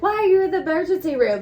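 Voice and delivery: fuming at other patients idling in the ER waiting room; indignant outburst. Retold or imitated. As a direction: fire it off in disbelief. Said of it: "I'm like".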